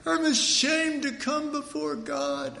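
high, slow mock-pitiful whine of the ashamed believer; whiny ashamed believer. Preacher mocks the ashamed churchgoer with a pitiful high whine. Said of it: "but we go".

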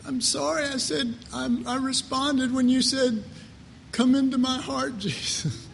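high, mock-plaintive prisoner voice; Jesus. Jesus explaining himself from inside the lockbox heart, high mock plaintive voice. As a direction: make a comic, high-pitched plea from captivity. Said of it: "Jesus is there standing at the bar going".

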